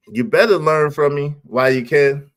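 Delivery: ordinary talk, nothing marked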